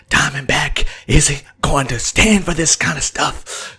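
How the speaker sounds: imitating Shades' breathy menacing hush; whispered voice. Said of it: "Shades always talking like this gr-, this, uh, whispered voice".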